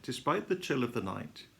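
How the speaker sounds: plain reading voice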